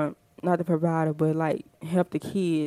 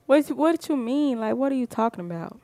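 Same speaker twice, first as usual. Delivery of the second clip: higher-pitched, disbelieving comeback; incredulous challenge; 6 semitones higher than usual. Acted. Woman answering the man's pitch, in an incredulous challenge. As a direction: question him in mock disbelief. Acts out woman's reply to boyfriend.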